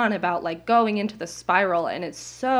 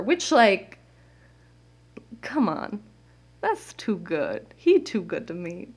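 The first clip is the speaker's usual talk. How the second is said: ordinary talk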